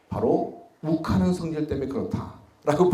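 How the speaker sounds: usual speaking voice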